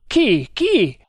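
loud, high, stabbing questions; furious. A narrator's reading. Tremal Naik demanding the attackers' names, furious. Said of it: "con furore".

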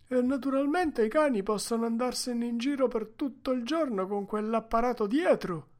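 high, loud put-on voice, mock-agreeing; sarcastic onlooker. A narrator's reading. Another man mocking the overloaded sled, high loud sarcasm. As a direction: pipe up high and loud with heavy irony.

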